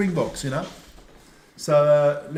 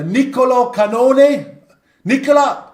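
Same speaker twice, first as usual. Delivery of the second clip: raised, loud Italian-accented name call; best Italian accent; 4 semitones higher, 7 dB louder than usual. Shown as a demonstration. Host announcing the Italian team, loud Italian-accented flourish. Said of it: "your best Italian accent".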